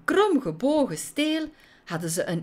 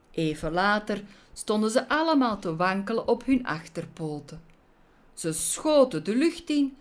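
usual speaking voice

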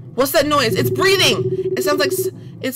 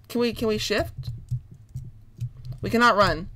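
usual speaking voice

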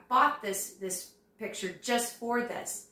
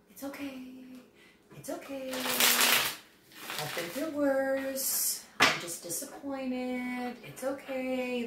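calm resigned reassurance; 4 semitones higher, slower than usual. Retold or imitated. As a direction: gently tell yourself it's okay. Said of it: "you're very calm about this".

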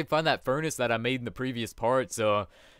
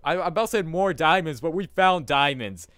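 loud, high, laughing joy at the find; excited; 6 semitones higher, 8 dB louder, slower than usual. Real, not acted. Gamer to his viewers after finding diamonds, excited laughing cheer.

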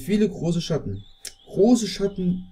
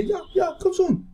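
excited urging. Real, not acted. Urging the fish to bite.

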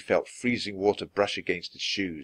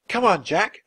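high, louder call up the stairs; shouting; 10 semitones higher, 5 dB louder than usual. A narrator's reading. Annie calls her brother up the stairs, shouting. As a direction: hurry him along. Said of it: "Annie shouted".

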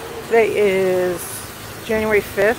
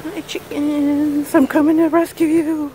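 sing-song pet talk; 6 semitones higher than usual. Acted. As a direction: coo at the chickens like babies. Greeting chickens in baby talk.

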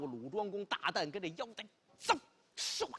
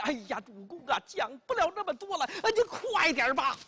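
urgent; 7 semitones higher, 3 dB louder than usual. Acted. Liangzi urging lord to hurry.

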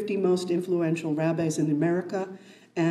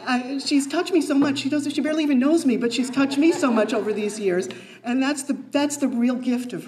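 getting emotional; 6 semitones higher than usual. Real, not acted. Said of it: "I'm getting emotional".

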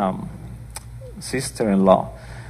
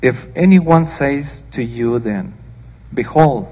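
louder, declaiming Bible reading; scripture reading; 4 dB louder than usual. Acted. Preacher reading the Gospel aloud to her congregation, declaiming. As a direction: read it out to the church with weight.

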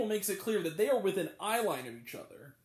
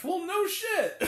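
slow, higher, drawn-out sarcasm; sarcastic; 9 semitones higher, 4 dB louder, much slower than usual. Retold or imitated. Reviewer sarcastically answers the movie line, slow. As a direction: stretch it out with disbelief.

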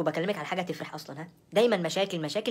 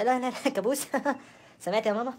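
higher, laughing protest; scandalised giggle, pitched up; laughing indignation; 6 semitones higher than usual. Acted. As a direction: repeat the insult through laughter. Laughs at husband's insult, tells mother.

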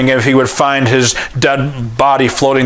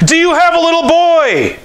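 frantic shout. Retold or imitated. Stranger yelling to the worried father, high panicked shout. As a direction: shout it down the hill in alarm.

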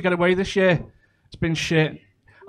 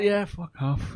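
muttered brush-off; 10 dB quieter, slower than usual. Retold or imitated. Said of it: "I was like".

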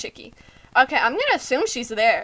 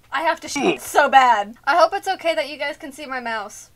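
ordinary talk, nothing marked

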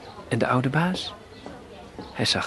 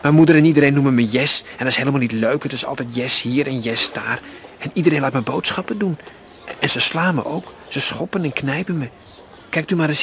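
lightly voiced little girl, eager and louder; chattering girl; 3 semitones higher, 7 dB louder than usual. A narrator's reading. Jessica tells the old man about beatings, eager chatter. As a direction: let a hint of a slum girl spill her complaints. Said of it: "En ze babbelde nu verder".

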